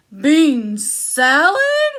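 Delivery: loud, slow disbelieving giant; giant outraged. Acted. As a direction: bellow it in disgust. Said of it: "he cried".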